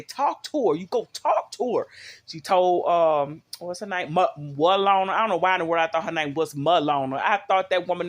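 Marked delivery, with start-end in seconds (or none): 0.0-1.9 higher, firm motherly order to go talk to her; a hint of a stern mother, pushing her out the door; Florida's voice. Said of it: "She say".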